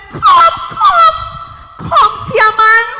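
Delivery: very high, loud shout; calling out. Acted. Woman summons her fellow villagers to see Jesus, calling out. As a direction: holler urgently to summon everyone. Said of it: "and I told them".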